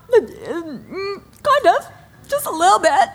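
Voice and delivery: high, strained, teary admission; admitting tears. Retold or imitated. Mother admitting to her son she's crying, high and teary. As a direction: squeeze the words out through tears. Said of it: "Are you crying".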